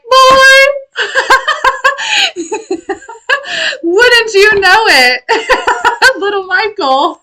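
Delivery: high, loud, laughing through the words; laughing mock exasperation. Mother retelling her reply to her son, laughing high. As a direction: crack up while playing fake-exasperated. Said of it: "And I was like".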